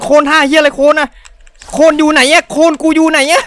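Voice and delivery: shrill, high, loud squeal of frustration; frustrated high yell. Real, not acted. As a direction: yelp it out high like you've lost it. Frustrated over a missing combo.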